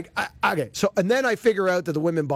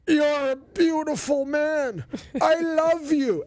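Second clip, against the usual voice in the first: put-on slow, high slurred drunk adoration; drunk fan; 11 semitones higher, slower than usual. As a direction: gush like a drunk stranger. Drunk fan adoring the host.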